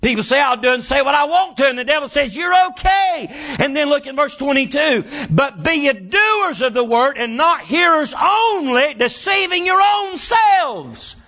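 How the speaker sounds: shouted, high preaching cadence; preaching at full force. Acted. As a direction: lift it into a full-throated sermon shout.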